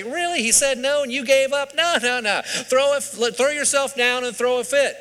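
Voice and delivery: high-pitched, mock-scandalized disbelief; incredulous goading. Opie's new friend telling him how to get things, incredulous. Friend goads Opie to demand.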